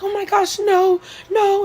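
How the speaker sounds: imitating a frightened, high-pitched plea; high mock panic, like a scared teacher; afraid. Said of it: "She just sounds so afraid".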